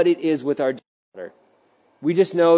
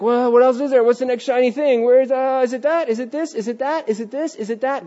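higher, darting questions; restless searching; 8 semitones higher than usual. Retold or imitated. Restless believer chasing shiny things, darting. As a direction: flit from thing to thing like a distracted heart. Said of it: "we're just like".

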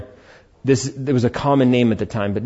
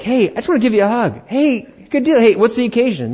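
bright, much higher, fast friendly hello; cheery greeting; 10 semitones higher, faster than usual. Retold or imitated. Pastor acting out greeting a friend, high and cheery. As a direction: greet someone brightly out of the blue.

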